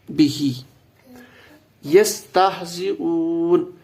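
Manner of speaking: slow, drawn-out recital of یستہزئون with the final -oon sound held; Quranic recitation. Shown as a demonstration. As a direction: intone یستہزئون slowly, as read from the Quran. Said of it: "اب یستہزئون میں اینڈ پہ اونا sound".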